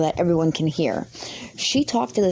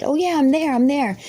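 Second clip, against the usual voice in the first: mock eager yes; 8 semitones higher than usual. Mock eager agreement to the kid.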